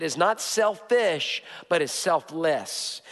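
slow, drawn-out contrast. Acted. Pastor contrasting selfish and selfless slowly. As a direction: weigh selfish against selfless.

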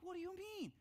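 high, startled question; puzzled surprise. Retold or imitated. Husband answering his wife, high and puzzled. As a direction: ask it caught off guard.

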